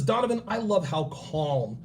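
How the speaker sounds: soft, lightly voiced wife; a hint of a sweet wife; wife's voice. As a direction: coo it like a smitten wife. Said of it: "She says".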